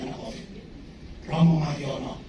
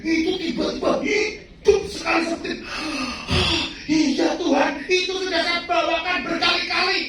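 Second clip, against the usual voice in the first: much higher, louder joyful preaching; fervent preacher testimony; preaching at full force; 12 semitones higher, 6 dB louder than usual. Real, not acted. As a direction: proclaim it with fervour.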